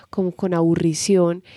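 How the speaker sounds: ordinary talk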